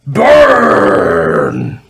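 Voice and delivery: deep, loud drawn-out roar; booming low monster shout; monster's roar. Acted. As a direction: roar it out long and low like a troll. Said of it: "and it says".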